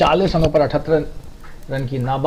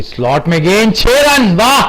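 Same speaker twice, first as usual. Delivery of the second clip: excited commentary; 6 semitones higher than usual. Real, not acted. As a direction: shout the six with joy. Sixes rain, commentator elated. (जोशीली commentary)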